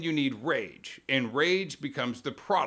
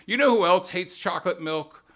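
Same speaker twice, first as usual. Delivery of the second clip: louder, pointed "You know who else hates chocolate milk?"; mock pundit outrage; 4 semitones higher, 6 dB louder than usual. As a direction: punch it like a host stirring the crowd. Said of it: "so here's how it goes".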